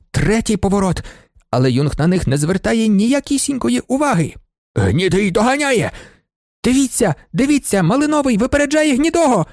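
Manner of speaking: rising, high-pitched spectator excitement; excited race commentary. A narrator's reading. Spectators watching one horse overtake another, mounting excitement.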